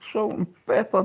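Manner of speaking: high mock repeat. To the chat, repeating his own phrase, high and mocking. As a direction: echo Salt and pepper up high as a joke.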